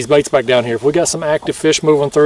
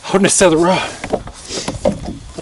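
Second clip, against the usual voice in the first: out of breath; 2 semitones higher, 3 dB quieter, much slower than usual. Real, not acted. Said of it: "I'm out of breath".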